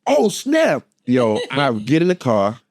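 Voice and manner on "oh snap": high-pitched exclamation of alarm; hammed-up high yelp of surprise; mock alarm. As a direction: act out a sudden shocked realisation. Husband realises the baby is coming.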